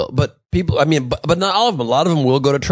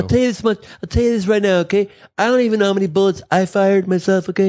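higher-pitched blustering politician; rambling boast in a pitched-up candidate voice; candidate impression; 6 semitones higher than usual. Host as the president losing count of shots, blustering impression.

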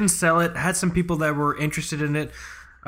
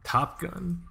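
put-on Russian accent; 5 semitones higher, 5 dB quieter than usual. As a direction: fake Russian accent, clipped words. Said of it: "I have to stop doing a fake Russian accent".

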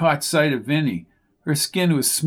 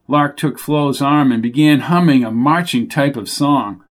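usual speaking voice

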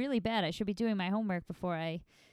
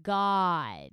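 nasal drawn-out Janice "Oh my God", loud at the end; Janice impression; 3 dB louder, much slower than usual. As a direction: stretch it out like Janice from Friends, punching God. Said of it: "if I did the impression right now".

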